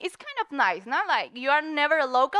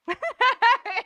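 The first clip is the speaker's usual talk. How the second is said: high burst of laughter; 11 semitones higher, 7 dB louder than usual. Real, not acted. Describing laughing till it hurts.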